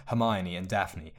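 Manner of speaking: ordinary delivery, nothing marked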